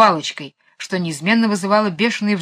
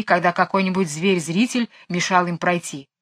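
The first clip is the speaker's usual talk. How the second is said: ordinary delivery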